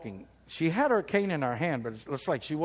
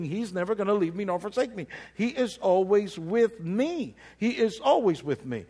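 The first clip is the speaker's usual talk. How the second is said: rising preacher's voice, louder; preaching; 4 semitones higher, 3 dB louder than usual. Acted. Preacher assuring the church God stays near, preaching. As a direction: preach it to the congregation.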